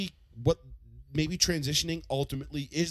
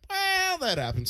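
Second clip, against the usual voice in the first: unbothered dad; 14 semitones higher, slower than usual. Retold or imitated. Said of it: "And he'd be like".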